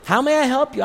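high-pitched, keen offer to a customer; eager store helper. Acted. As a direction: play the over-helpful shop assistant.

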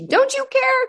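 put-on high, whining teenage complaint; mock the daughter's wail, way up high; whiny teen daughter. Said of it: "And then she".

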